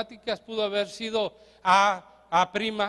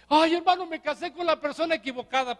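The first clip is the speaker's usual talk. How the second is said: whiny congregant; 6 semitones higher than usual. Retold or imitated. Congregant voiced complaining to pastor.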